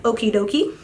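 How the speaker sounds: mock chirpy okie dokie, a touch of her voice; lightly voiced doctor, sing-song; imitating the doctor. Scene: mom mocks her doctor's okie dokie to viewers, light mimicry. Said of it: "She's like".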